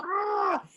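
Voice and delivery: high, held-back "Ah" yell; mock Mexican yell. Joke teller doing the cowboy's celebratory yell, soft and high. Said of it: "he gives out a Mexican yell".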